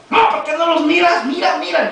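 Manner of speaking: high, loud imitated pleading complaint; reproachful protest. Preacher voices daughter insisting papá look at her tumors, reproachful. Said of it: "ella iba y le reclamaba al papá".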